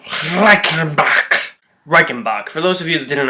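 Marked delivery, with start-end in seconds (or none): 0.1-1.5 slow, drawn-out German accent. Shown as a demonstration. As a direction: say it the German way, stretched out. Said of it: "My German accent is bad".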